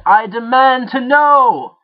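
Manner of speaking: high, loud, slow demand; shouting. A narrator's reading. Jake demanding the voice reveal itself, shouting. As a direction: bellow every word. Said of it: "Jake shouted".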